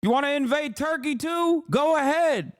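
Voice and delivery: put-on very high, strained old-man caricature; hammed-up senile president impression; Joe Biden. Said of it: "And then Biden would like".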